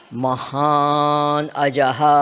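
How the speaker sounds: slow and low, each syllable weighted; slow emphatic answer. Real, not acted. Teacher answers own question slowly.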